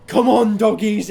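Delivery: loud, eager call to the pack; rally the dogs to follow you; playful shouted summons. Acted.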